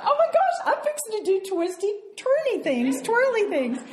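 giddy squeal. Retold or imitated. Woman asked to dance, squealing inside with delight. Thrilled inner thought.

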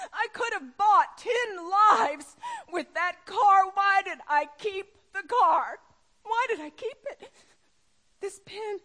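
anguished crying shout. Retold or imitated. Schindler to his accountant, anguished sobbing shout of regret. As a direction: wail it out in regret. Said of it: "He said".

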